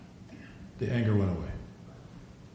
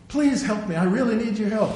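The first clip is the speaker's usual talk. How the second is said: higher-pitched mock pleading; acted pleading; 9 semitones higher than usual. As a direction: plead for help in a raised voice. Acting a pleading prayer to Father.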